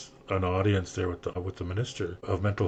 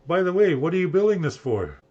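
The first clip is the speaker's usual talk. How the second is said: higher, louder retold question; calling back; 9 semitones higher, 7 dB louder than usual. Builder turning back to ask the teller, calling out. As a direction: toss a curious question back over distance. Builder turns round, asks from away.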